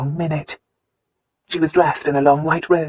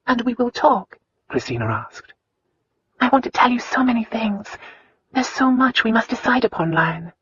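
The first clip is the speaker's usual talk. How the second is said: put-on higher, earnest young woman's voice; tender young wife; 8 semitones higher than usual. A narrator's reading. Wife opening her heart to her husband, higher.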